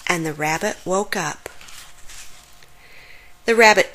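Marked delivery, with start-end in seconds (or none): none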